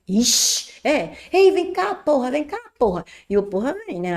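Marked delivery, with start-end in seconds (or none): 1.3-3.0 calling someone over. Retold or imitated. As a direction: shout for a buddy to come over. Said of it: "que vocês falam".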